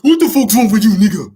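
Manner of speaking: put-on loud, high-pitched West African accent; annoyed dad bursting into a thick West African accent. Father snapping at his pestering son, annoyed West African accent. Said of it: "He pulls out his African accent".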